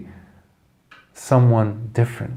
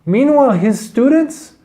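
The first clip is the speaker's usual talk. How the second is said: ordinary delivery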